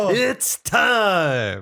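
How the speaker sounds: loud, high announcer shout; ring announcer. Pretend. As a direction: call it out to the arena. Joins ring announcer parody.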